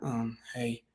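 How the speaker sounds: low, soft, listless hey; low-energy greeting. Shown as a demonstration. Coach showing a wrong answer to a seller's call, low-energy. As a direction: mumble it with no energy. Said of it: "You don't wanna be like".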